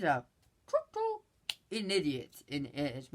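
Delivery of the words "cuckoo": cuckoo squeaked very high; teasing high cuckoo; mocking cuckoo. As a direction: sing cuckoo up high like a clock bird. Calling the director crazy.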